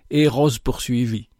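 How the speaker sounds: plain reading voice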